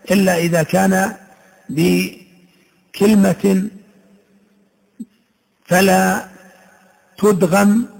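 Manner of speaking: slow chanted recital of verse; chanted teaching poem; poem recitation. Shown as a demonstration. Recites didactic poem lines.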